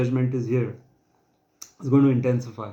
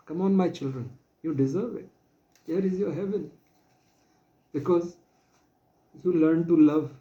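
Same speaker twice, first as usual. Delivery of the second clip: put-on higher, tender voice welcoming beloved children; emotional; 5 semitones higher than usual. Judge welcomes the compassionate children into heaven, emotional and high. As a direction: speak as a loving judge, voice lifted with emotion. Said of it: "Sorry, I got emotional".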